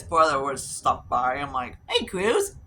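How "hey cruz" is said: high sing-song call to the cat; cat greeting. Retold or imitated.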